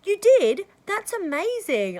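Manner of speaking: high and loud, then a slow amazed That's amazing; delighted praise for a stranger's passed assignment. Retold or imitated. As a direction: cheer their news with delight. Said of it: "And I'll say".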